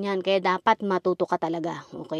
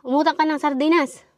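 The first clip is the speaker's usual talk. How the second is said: higher, louder bossy mother voice; mock the mother's order, pitched up; 7 semitones higher, 4 dB louder than usual. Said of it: "Sabi niya".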